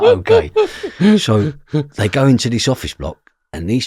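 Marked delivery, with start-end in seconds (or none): 0.0-1.2 very high squeaked okay; a squeaky nervous yes; squeaked reply to the robbers; startled okay. Retold or imitated. Boy agrees to mad plan.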